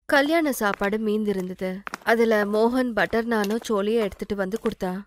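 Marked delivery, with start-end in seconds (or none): none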